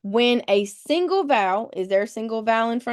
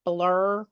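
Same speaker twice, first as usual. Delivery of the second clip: "blur" with a long, held R at the end; stressed final R. Shown as a demonstration. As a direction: lean on the R that closes "blur". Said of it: "and stress that R".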